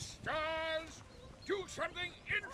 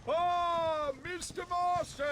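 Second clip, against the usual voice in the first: delighted greeting; 6 semitones higher, 7 dB louder, slower than usual. Acted. Director greets arriving friend.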